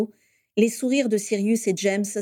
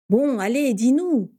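higher, louder, eager urging; excited friend begging for the news; impatient; 5 semitones higher, 4 dB louder, slower than usual. Acted. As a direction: hurry him up, bursting with impatience. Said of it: "s'impatienta Sirius".

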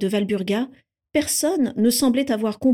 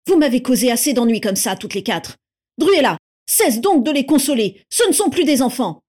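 loud, raised angry scolding; furious; 4 semitones higher, 6 dB louder than usual. A narrator's reading. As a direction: shout them down as control slips away. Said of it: "furieux de sentir la situation lui échapper".

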